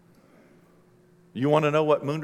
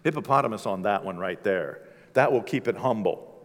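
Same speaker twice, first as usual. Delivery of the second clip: low, quiet Adam voice marveling; voicing Adam; 10 semitones lower, 6 dB quieter than usual. Speaker voicing Adam in awe, low and hushed. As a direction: drop deep and soft as awed Adam. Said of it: "And Adam's like".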